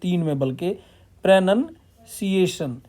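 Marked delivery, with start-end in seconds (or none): 1.2-2.8 प्रोनंसिएशन drawn out slowly, part by part; teacher spells out प्रोनंसिएशन slowly by its parts; slow syllable demo. Shown as a demonstration. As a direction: split प्रोनंसिएशन into its pieces, slowly. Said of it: "इस प्रोनंसिएशन को दो हिस्सों में बांट".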